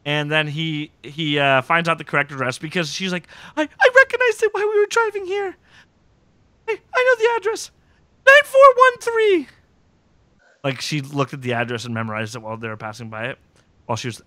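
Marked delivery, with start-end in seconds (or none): 3.6-9.5 very high falsetto, rising to loud; mock panicky woman. Host mimics captive giving up the address, mock panicky. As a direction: imitate her stammering in a squeaky voice.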